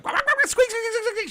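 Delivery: high squeak squeak, imitating a chew toy; squeaky toy noises. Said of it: "and she's like".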